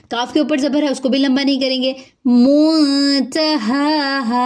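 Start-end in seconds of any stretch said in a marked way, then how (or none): 2.2-4.3 منتہاہا with a hidden nasal n and both ha's long; tajweed recitation. Shown as a demonstration. Teacher reciting for students, very slow. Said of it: "نون ساکن کے بعد تا ہے تو اخفا ہو رہا ہے".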